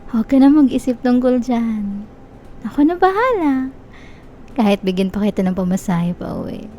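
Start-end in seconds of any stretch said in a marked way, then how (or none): none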